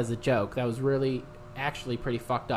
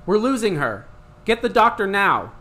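loud, urgent hospital call; urgent shout; 4 semitones higher, 8 dB louder than usual. Acted. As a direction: bark it like an ER doctor. Doctor in story emergency.

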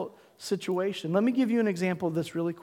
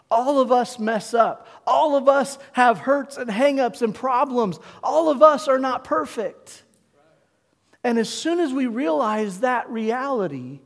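full-force preaching; 4 semitones higher, 5 dB louder than usual. Acted. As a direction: hammer each line home.

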